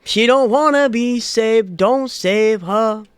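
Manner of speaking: loud, slow proclamation. Acted. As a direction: declare it like a prophecy. Character quotes solemnly.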